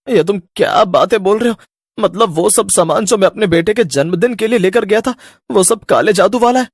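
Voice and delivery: high, loud stunned questions; can't believe the goods are cursed; shocked disbelief. Acted. Frightened father learning the birthday goods are cursed, high and shocked.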